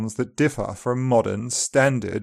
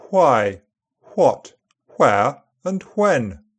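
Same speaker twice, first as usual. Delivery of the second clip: upper RP accent; slower than usual. Shown as a demonstration. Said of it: "Words starting W H are pronounced with".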